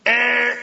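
loud, high buzzer noise; wrong-answer buzzer. Acted. Preacher rejects the misreading, mimicking a buzzer. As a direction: buzz like a game show rejecting an answer.

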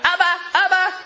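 put-on high, loud child's shout; kids calling out. Preacher imitates kids in Jerusalem's streets, child calling out. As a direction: holler for Daddy like an eager little kid.

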